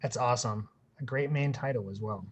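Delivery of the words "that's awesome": ordinary delivery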